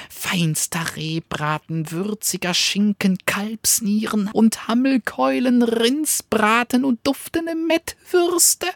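high, fast, bragging dog voice; sly little dog. Acted. Cunning little dog tempts the wolf, high and eager. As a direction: tempt him with a tiny eager voice.

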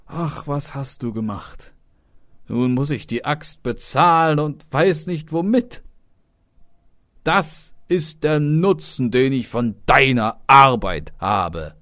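high, dismayed outcry turning to loud reproach; alarmed father. A narrator's reading. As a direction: start aghast, build into loud scolding. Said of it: "Da erschrak der Vater und sprach".

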